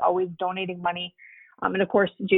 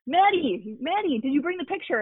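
imitating an eager greeting, pitched higher; eager calling out; 7 semitones higher than usual. Hockey player greeting his young fan, retold as eager calling out. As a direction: call her name eagerly, higher voice.

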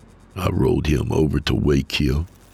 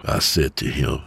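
plain reading voice

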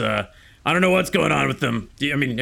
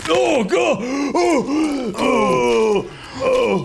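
high pained yelps; just hit in the groin; 8 semitones higher, much slower than usual. Acted. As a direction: cry out from a kick to the groin. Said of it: "you kicked me in the balls".

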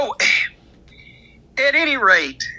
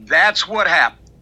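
normal talking voice

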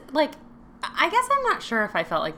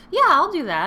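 Megan agreeing; 10 semitones higher, 9 dB louder than usual. Retold or imitated.

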